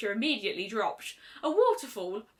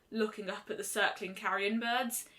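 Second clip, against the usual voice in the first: usual speaking voice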